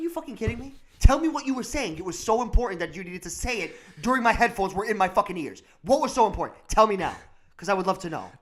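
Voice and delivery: mock angry rant. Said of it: "I'm like".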